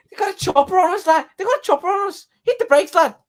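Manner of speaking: very high, loud imitation of a Scouser; mock Scouse shout. Lads warning of a police chopper, mock Scouse yelling. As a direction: yell in a piercing put-on Liverpool voice. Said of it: "the Scouse accent just goes through me".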